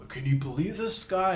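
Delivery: imitating someone mildly outraged; mock disbelief. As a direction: act out a dad who can't believe it. Retelling outraged father's reaction.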